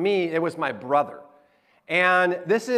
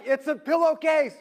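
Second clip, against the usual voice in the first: high-pitched mock enthusiasm; excited explaining, acted; 8 semitones higher than usual. Dad to puzzled family about his gift, mock excited explaining. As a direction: play up selling an underwhelming gift.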